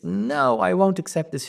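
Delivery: mildly acted angry no; enraged refusal. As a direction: refuse it, a touch of rage. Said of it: "She's, she's enraged".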